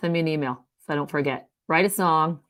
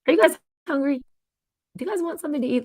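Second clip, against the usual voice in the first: mock chirpy host; 9 semitones higher, 4 dB louder, faster than usual. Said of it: "they do it in a negative way".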